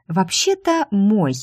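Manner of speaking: object, drawing the words out; protesting. Acted. Secretary disputes the rival's claim on boss, protesting. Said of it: "запротестовала я".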